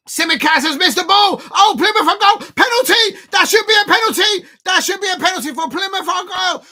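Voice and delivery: high, loud football shout; outraged penalty appeal. Real, not acted. Fan demands a penalty for Plymouth, outraged shouting. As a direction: yell for the penalty at full voice.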